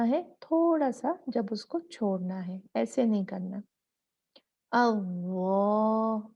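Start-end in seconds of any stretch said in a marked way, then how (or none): 4.7-6.3 अव्वा drawn out slowly with a व; wrong pronunciation. Shown as a demonstration. Teacher demonstrates wrong अव्वा to avoid. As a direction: stretch अव्वा with the forward-tongue error. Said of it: "अव्वा गलत है".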